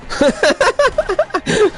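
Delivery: real laugh after the game kill; burst of laughter. Real, not acted. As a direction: let out a delighted high laugh at the kill.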